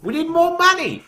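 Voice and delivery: high, loud greedy guard voice; hammed-up greedy guard, pitched high; mock greedy guard. Retelling guards demanding money.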